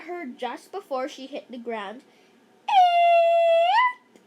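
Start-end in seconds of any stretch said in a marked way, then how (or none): 2.7-4.2 shrill, loud high scream; high squeal. Acted. Kids screaming as the bear appears, high squeal. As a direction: squeal in sudden fright. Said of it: "We all screamed".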